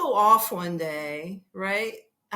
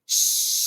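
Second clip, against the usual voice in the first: unvoiced loud hiss of a bug spray; 6 dB louder than usual. Traveler spraying the spider, a loud hissing spray sound. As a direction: imitate the spray can with a sharp hiss. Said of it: "So I'm like".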